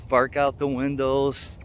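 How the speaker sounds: ordinary talk, nothing marked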